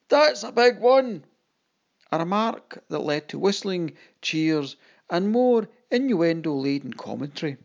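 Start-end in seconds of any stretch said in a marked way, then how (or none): 0.1-1.2 slow, high, loud cheeky call; shouted tease. Acted. Woman in the crowd teases the captain, a slow loud cheeky shout. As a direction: shout a bawdy joke to the stage. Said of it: "One woman shouted out".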